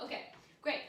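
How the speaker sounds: ordinary talk, nothing marked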